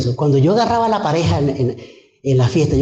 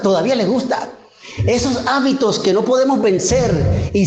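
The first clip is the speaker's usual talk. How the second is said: higher, rising preacher's fervour; fervent preaching; 8 semitones higher than usual. Acted. Preacher exhorting the congregation, raised fervent voice. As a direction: preach it to the congregation with mounting conviction.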